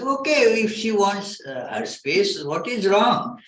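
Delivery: naive puzzled husband. Retold or imitated. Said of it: "I said".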